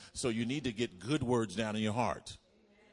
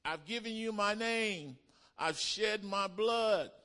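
voicing Jesus; 10 semitones higher than usual. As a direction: speak as Jesus, weighty. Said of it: "He said".

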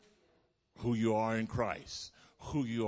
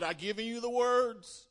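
high, loud emphatic declaration; voicing Jesus; 12 semitones higher, 5 dB louder than usual. Preacher voicing Jesus to the congregation, high firm declaration. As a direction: speak as Jesus, firmly. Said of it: "He said".

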